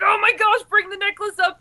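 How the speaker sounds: high, loud gushing; gushing quote from Gab, high and loud; excited. Retold or imitated. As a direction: squeal with delight. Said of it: "Gab's like".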